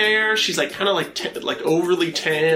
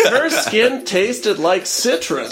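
high, slow, swooning parody; mock Penthouse-letter reader; 4 semitones higher, slower than usual. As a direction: savor each word in mock erotica. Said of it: "[name] reading penthouse letters".

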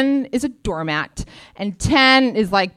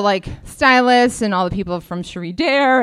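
ordinary talk, nothing marked

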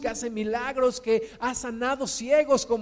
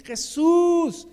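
crying out; 2 semitones higher, 4 dB louder than usual. Acted. Blind beggar calling out to Jesus, crying out. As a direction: call out to be heard over a crowd. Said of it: "comenzó a dar voces".

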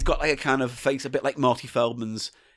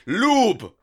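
high, loud one-word caveman demand; 10 semitones higher, 5 dB louder than usual. Acted. Co-host joining the lube sketch, caveman shout. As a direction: call it out like a caveman.

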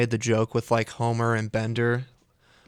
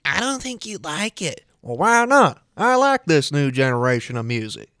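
high-pitched cartoon character voices; King of the Hill impression; 5 semitones higher, 3 dB louder than usual. Replaying a cartoon scene for a friend, high put-on voices. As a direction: act out the meme scene, pitched well up. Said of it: "memes made from that scene".

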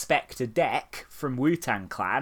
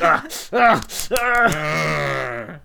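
mock Nazi shudder; 2 semitones higher, 7 dB louder, much slower than usual. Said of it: "Being like".

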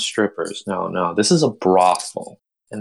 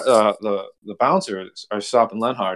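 ordinary talk

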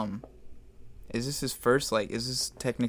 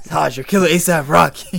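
put-on loud, high drunk old man yell; 7 semitones higher, 13 dB louder than usual.

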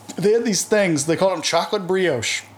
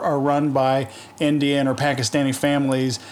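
normal talking voice

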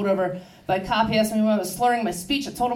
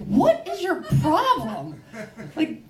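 high, drawn-out mock outrage; acted exasperation; 8 semitones higher, slower than usual. Dog owner arguing with complaining neighbour, acted exasperation. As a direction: stretch it out in exasperated disbelief.

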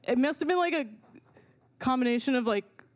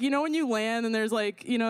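ordinary talk, nothing marked

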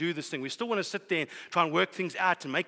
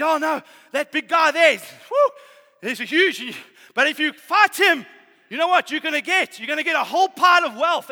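loud, higher, slowed hammed-up warning; mock alarmed soldiers; 7 semitones higher, 11 dB louder than usual. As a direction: play the panicked soldier pitching the prize. Soldiers warn David about Goliath.

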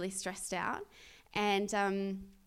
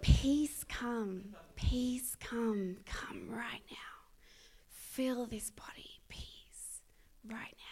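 hushed prayer, fading softer; whispered healing prayer; 4 dB quieter, much slower than usual. Retold or imitated. Praying over Lewis's back for peace, whispered. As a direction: breathe the command softly, fading to a whisper.

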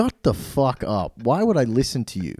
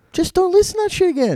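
exasperated; 4 dB louder than usual. Real, not acted. Annoyed rant at critics.

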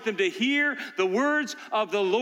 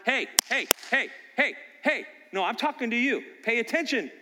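raised, insistent call for attention; dad demanding his kids listen, raised voice; father calling out; 5 semitones higher than usual. Acted. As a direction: snap them to attention.

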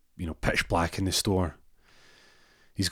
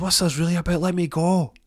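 high, louder panicked voice; high panicked plea; 11 semitones higher, 5 dB louder than usual. Retold or imitated. Rory trapped in the cupboard, high panicked plea. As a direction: beg to be let out, voice up high.

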